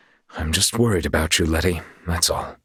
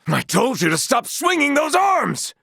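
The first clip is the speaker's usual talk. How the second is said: spellbound shout. Acted. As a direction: yell at him to stop swinging. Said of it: "Was I under some spell?".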